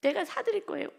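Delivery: high eager promise; sincere young woman. Shown as a demonstration. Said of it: "이렇게 정말 진심으로". (높고 간절한 약속)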